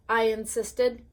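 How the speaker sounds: usual speaking voice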